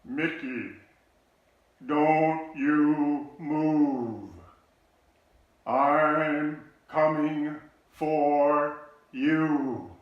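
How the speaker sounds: loud, very slow drawn-out call; calling out from above. Retold or imitated. Older brother calls down to lost little brother, loud slow call. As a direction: call down to a lost little kid far below.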